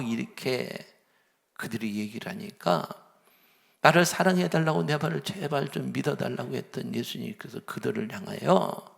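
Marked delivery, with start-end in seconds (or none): none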